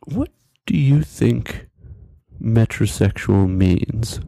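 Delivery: very slow, spaced-out question; slow, careful probing. Retold or imitated. Checking what friend thinks it means, slow and wary. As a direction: weigh each word warily.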